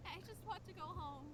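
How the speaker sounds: sad, defeated. Acted. Broken girl.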